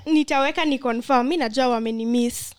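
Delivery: ordinary talk, nothing marked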